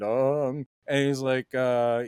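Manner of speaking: ordinary talk, nothing marked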